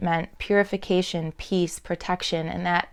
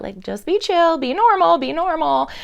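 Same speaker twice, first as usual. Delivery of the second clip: imitating someone reining themselves in, pitched up high; higher, louder mock plea to keep it together; urgent self-shushing; 8 semitones higher, 5 dB louder than usual. Voices people suppressing their emotion.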